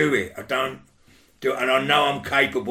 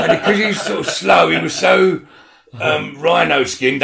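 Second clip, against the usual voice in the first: laughing; 6 semitones higher, 8 dB louder than usual. Real, not acted. Laughing while telling the story.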